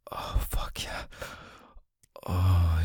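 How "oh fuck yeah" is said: breathy unvoiced whisper, close to the mic. Lover to partner in bed, whispered. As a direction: breathe it out without voice. Intimate roleplay, whispered line.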